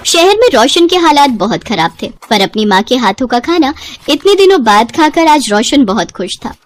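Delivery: plain reading voice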